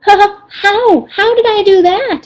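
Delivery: high, trembly, small pleading; timid Piglet frightened by Rabbit; frightened little voice. Acted. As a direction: shrink back, scared and small. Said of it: "in a frightened little voice".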